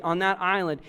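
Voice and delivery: ordinary talk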